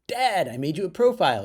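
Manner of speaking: high, loud imitation of a thrilled daughter; excited kid proudly breaking big news; proud announcement. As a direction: burst out with it, very pleased with yourself. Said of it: "she announced, her face beaming with pride".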